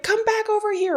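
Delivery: high-pitched call to the dog; calling the dog back. Retold or imitated. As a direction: sing out to bring the dog back. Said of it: "Or you were like".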